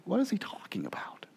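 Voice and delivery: hushed wondering aside; whispered aside. Real, not acted. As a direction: lean in and whisper the question. Preacher's puzzled aside.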